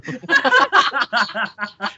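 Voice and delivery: high burst of laughter; laughing. Real, not acted. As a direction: cracks up. Laughing at the story.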